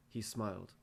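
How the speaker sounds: ordinary delivery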